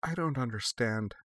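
soft, lost and bewildered; understated, emptied-out confusion; slightly hollow. A narrator's reading. Young woman answering her superior's question, hollowly. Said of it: "her voice sounding slightly hollow".

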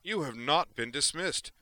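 loud, high, commanding rebuke; outraged authority at full volume; angry bellow. A narrator's reading. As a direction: stop them in their tracks with a shout. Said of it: "Dareth bellowed".

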